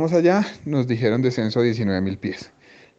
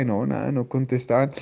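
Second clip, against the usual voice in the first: normal talking voice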